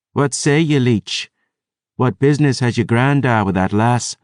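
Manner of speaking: a gruff-toned challenge in a broad brogue; Scottish-style brogue. Acted. Dusk Dancer voiced by narrator.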